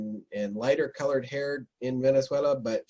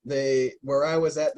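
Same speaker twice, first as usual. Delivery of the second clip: usual speaking voice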